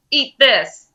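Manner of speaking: loud defiant cry; battle shout. Acted. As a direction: hurl it with the spell. Casting a spell, shouting.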